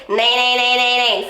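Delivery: bright bratty nay; bright, cutting twang. Shown as a demonstration. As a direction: cut through with a bratty twang. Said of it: "twang is this really bright kind of quality".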